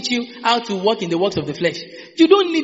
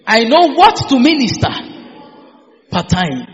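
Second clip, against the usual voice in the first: leading a confession. Shown as a demonstration. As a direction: call it out line by line for them to echo. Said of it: "Say with me".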